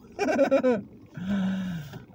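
high bubbling laugh. Real, not acted. Man amused after his calm demonstration, laughing.

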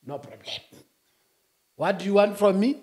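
ordinary talk

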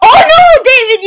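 loud, very high wail of dismay; dismayed yell. Real, not acted. Player killed in front of friend David, dismayed yell. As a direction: yell high as it all goes wrong.

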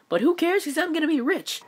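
very high, gleeful squeal; giddy gloating. Acted. Deadpool gloating over his gold, giddy squeal. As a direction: squeal it with delight.

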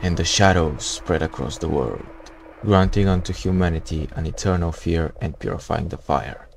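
low, soft storyteller voice; ominous narration. Acted. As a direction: read it like a dark epilogue. Reads the game's ending text.

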